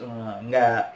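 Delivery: ordinary talk, nothing marked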